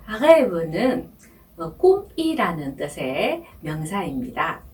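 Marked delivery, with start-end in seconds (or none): none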